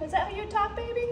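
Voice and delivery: pet talk. Real, not acted. Baby talk to the cat.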